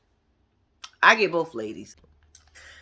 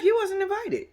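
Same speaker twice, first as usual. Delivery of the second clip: much higher, curt brush-off; dismissive Mel; 12 semitones higher than usual. Retold or imitated. Mel shutting Martell down, high and dismissive. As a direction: give him nothing but dust. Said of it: "she gives his ass dust".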